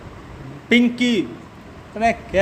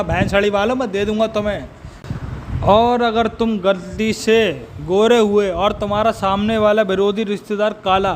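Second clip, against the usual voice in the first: ordinary delivery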